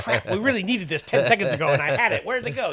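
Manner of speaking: hammed-up classroom panic; teacher hunting a lost item, laughing mock frenzy; mock frantic teacher. Frantic teacher losing a supply.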